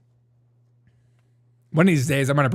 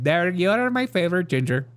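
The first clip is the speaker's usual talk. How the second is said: higher, put-on Indian accent; 4 semitones higher than usual. Neighbor who loves Derek, imitated Indian accent. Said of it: "my worst Indian impression".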